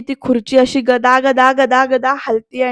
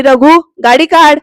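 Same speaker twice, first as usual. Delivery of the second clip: a touch louder, slow commanding call to the driver; mildly raised, slow command for the car; calling out an order; 3 semitones higher, 6 dB louder, slower than usual. Retold or imitated. Grandmother calls to her driver Raghu to bring the car, in a slow raised command.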